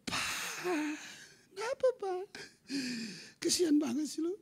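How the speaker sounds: very high mock feminine voice; wife's voice. Imitating his wife pitying and coaxing him, high voice. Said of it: "Istri saya langsung".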